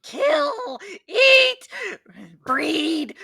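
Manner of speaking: high, loud monster screech; rat creature shout. Acted. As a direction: shriek it like a feral beast.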